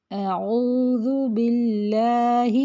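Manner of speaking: اعوذ باللہ slowly, ہی short; slow model recitation. Shown as a demonstration. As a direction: recite the phrase in clean tajweed. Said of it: "ہا زیر ہی". (اعوذ باللہ کی آہستہ تلاوت)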